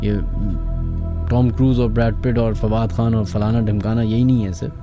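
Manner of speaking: normal talking voice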